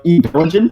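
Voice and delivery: "Evangelion" as "ee-VEL-uh-jun", questioning; echoed mispronunciation. Retold or imitated. Querying friend's slip, "Evangelion" echoed as "ee-VEL-uh-jun?". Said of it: "what did you say?".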